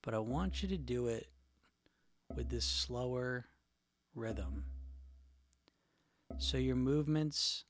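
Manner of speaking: calm and hushed, guide them gently; soft spa whisper. Acted. Leads a guided eating meditation.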